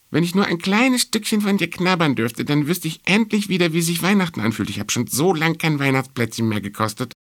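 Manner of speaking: high, pleading little mouse; mouse character. A narrator's reading. Mouse begging the star for a piece of cookie, high-voiced. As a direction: beg for a nibble in a high mouse voice.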